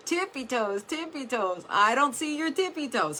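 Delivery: high, loud demanding impression; mean movie ape. Coach imitating the movie's ape drilling dancers, mean. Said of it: "this ape and he's terribly mean".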